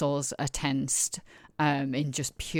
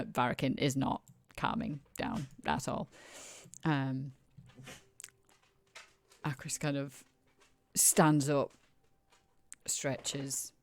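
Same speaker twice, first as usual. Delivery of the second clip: ordinary talk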